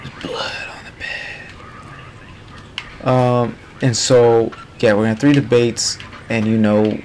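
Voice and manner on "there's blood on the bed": hushed creepy whisper. Real, not acted. Gamer whispering the grim find to viewers. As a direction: breathe it out in a whisper.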